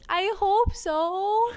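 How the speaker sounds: high, slow, loud hope; hopeful plea. Real, not acted. Cohost wishing her dad listens, high and drawn out. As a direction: stretch it out hopefully.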